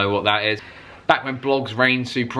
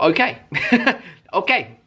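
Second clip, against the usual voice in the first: laughing; 7 semitones higher than usual. Retold or imitated. Laughing at the curt reply.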